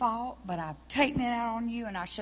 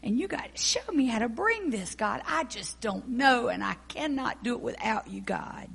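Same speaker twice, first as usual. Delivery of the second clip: earnest prayer; 4 dB louder than usual. Acted. Praying aloud to God.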